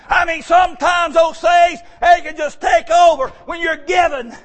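loud, driving preacher's voice; full-force preaching. Acted. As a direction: preach it hard to the congregation.

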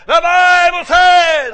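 slow, loud street-preacher call; megaphone preaching. Retold or imitated. Preacher on the Walmart corner with megaphone, slow booming call. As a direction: boom it out like through a megaphone. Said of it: "trustful that megaphone".

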